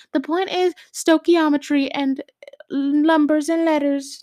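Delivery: high, loud imitation of a teacher; hammed-up lecturing teacher, stretching the last words; mock teacher. Teacher explaining the point to a student, mocking imitation. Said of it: "He's like".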